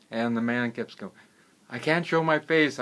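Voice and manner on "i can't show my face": high, louder protest of shame; ashamed pleading. Acted. Gardener hiding in the cave from the wealthy man, ashamed pleading. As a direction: plead in a raised voice.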